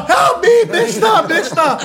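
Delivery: loud high calls for help, fading at the end; panicked yell. Retold or imitated. Inmate on the cell buzzer calling the guard, panicked yell. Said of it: "I'm on the buzzer".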